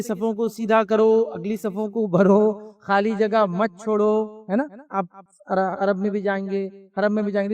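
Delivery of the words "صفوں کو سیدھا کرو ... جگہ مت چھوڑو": slow, drawn-out mosque announcement to the rows; imam's slow announcement. Retold or imitated. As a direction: call the rows slowly like an imam before prayer. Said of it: "وہ یہ بولتے کہ".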